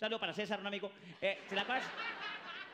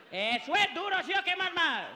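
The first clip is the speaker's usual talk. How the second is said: ordinary talk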